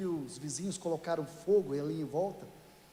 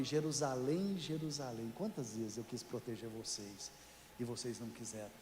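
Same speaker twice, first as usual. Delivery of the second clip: much softer, hushed grieving plea; sorrowful lament; 9 semitones lower, 9 dB quieter than usual. Retold or imitated. Jesus weeping over Jerusalem, hushed sorrowful lament. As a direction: let it sink almost to a sorrowing hush.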